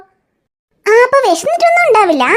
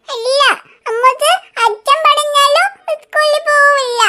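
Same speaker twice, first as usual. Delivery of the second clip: put-on slow, higher child voice; stubborn little girl; 6 semitones higher, slower than usual. Riya refusing school even if father asks. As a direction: pout it out like a small child.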